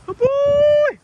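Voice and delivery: high, loud praise for the dog; squeaky praising a dog. Real, not acted. Praising dog after a catch.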